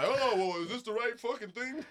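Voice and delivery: lightly voiced clueless instructor; dumb guy. Instructor pretending to be clueless with harness, mock dumb voice. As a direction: play the confused idiot. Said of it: "you're the dumb guy".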